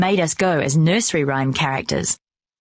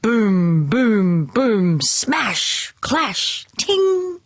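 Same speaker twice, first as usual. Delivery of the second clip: booming drum and clashing cymbal sounds; band noises; 3 semitones higher, much slower than usual. A narrator's reading. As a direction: mouth out a marching band. Band sounds imitated in story.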